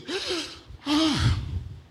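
high, exaggerated gasp; mock shaking gasp. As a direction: gasp as if seized by the spirit, imitating. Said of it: "used to people shaking".

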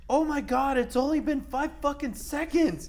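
high-pitched stoned disbelief; mock amazement. Friends staring at the microwave, high astonished squeal. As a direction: squeal it like time barely moved. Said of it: "being like".

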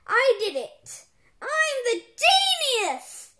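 high, loud self-glorifying shout; boastful yell. A narrator's reading. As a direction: claim all the credit, stretching the words out. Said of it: "yelled Professor Poopy Pants".

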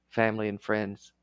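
ordinary talk, nothing marked

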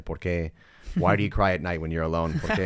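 imitating Gus's English quote; playful quote of a friend; quoting Gus. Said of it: "Gus siempre nos dice".

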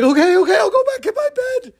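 very high, loud squeal; frantic obedience. Acted. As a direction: give in hastily, squealing with mock fright. Frightened man obeys the angel.

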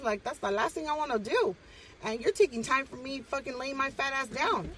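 high-pitched, put-upon telling-off; exasperated rant. Retold or imitated. Fed-up guardian scolding a suspended younger brother, exasperated rant. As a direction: act out a fed-up earful, pitched well up. Acted rant at troublemaking sibling.

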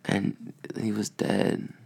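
soft, slow, heartbroken; quiet and sad. Real, not acted. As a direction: let it fall away gently. Retelling friend's death.

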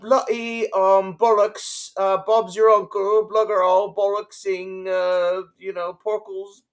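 high, loud hammed-up British accent; bad British accent. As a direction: overdo British slang in a terrible British accent. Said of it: "that was terrible".

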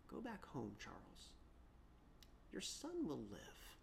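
soft, calm and sure; tender authority. Acted. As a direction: speak gently but with authority. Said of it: "balance of tenderness and mercy".

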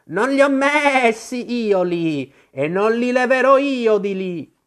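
high, loud, drawn-out refusal; rebuffing sheep. Acted. The sheep refuses responsibility for the flowers, high scolding refusal. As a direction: disown it crossly with stretched vowels.

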